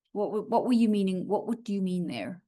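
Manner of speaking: usual speaking voice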